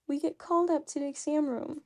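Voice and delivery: ordinary talk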